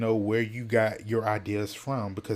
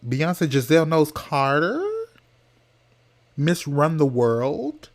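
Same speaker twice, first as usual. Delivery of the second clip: loud, higher, mocking list of titles; sassy incredulous; 5 semitones higher, 5 dB louder than usual. Real, not acted. Vlogger mocks a boy's [name] claim, loud high sass.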